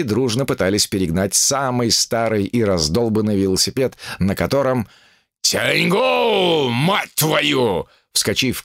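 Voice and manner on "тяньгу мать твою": yelling. Acted. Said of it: "крикнул бык".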